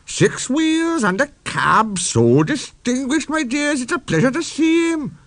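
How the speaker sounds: high tittering coaches fussing over a handsome engine. A narrator's reading. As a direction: coo it like giggling gossips. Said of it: "they tittered".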